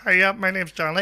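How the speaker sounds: high, loud mimicked greeting; imitated speech impediment. Stage manager greeting a performer, imitated impediment. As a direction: put on a high impeded voice, projected. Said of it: "a speech impediment".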